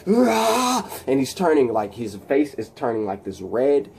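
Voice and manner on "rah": high, rough mock scream; imitated scream. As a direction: act out a powered-up villain's scream. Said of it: "he's screaming".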